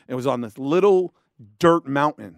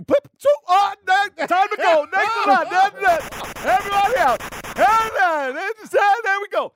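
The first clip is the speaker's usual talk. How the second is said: high, rushed panic; pitched-up mock panic; panicked. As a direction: scramble to get everyone out. Panicked DJ cutting the broadcast.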